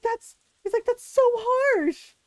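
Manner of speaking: high, slow, drawn-out whine; mock whiny ex. Ex boyfriend stung by being dumped, high slow whine. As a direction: stretch out so harsh like a wounded guy.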